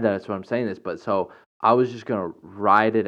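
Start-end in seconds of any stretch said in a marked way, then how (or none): none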